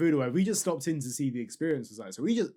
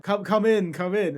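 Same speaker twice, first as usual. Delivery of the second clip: higher, louder coaxing call; eager beckoning; 6 semitones higher, 5 dB louder than usual. Retold or imitated. Tout luring two tourists inside, imitated eager beckoning. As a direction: imitate a tout urging them in.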